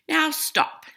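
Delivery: Max commanding. Acted. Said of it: "Max said".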